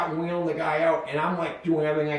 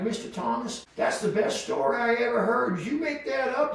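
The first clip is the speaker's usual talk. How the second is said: Texan professor; 6 semitones higher than usual. Retold or imitated. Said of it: "this wicked Texan guy".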